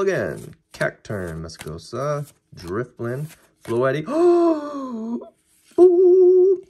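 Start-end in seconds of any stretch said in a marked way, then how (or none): 4.1-5.3 high surprised gasp; sharp gasp. Real, not acted. Seeing a chase card, a sudden high gasp. 5.8-6.6 very high, loud ooh; awed squeal. Real, not acted. Pulling the chase card, a loud high squeal.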